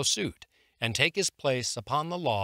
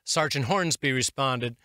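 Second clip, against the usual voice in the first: ordinary delivery, nothing marked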